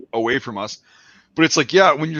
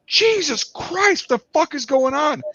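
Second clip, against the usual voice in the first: high-pitched, drawn-out disbelief; alarmed squeal, stretched slow; acted alarm; slower than usual. Soldier hearing the cat screech, acted alarm.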